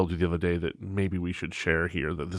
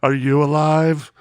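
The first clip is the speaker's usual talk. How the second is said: loud, slow, higher drawn-out drone; mock boss voice; 5 semitones higher, 5 dB louder, slower than usual. Joking imagined phone call to the boss, loud slow drone. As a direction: stretch the question out loudly.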